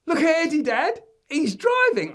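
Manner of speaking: put-on high, loud kid alerting Dad; piercing excited child; excited boy. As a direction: shout it to Dad in amazement. Excited boy telling on brother.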